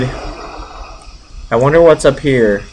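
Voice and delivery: ordinary delivery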